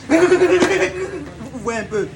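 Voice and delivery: high, slow, laugh-filled. Real, not acted. Presenter cracking up at his own stutter, laughing high and slow. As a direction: laugh through the words, drawn out.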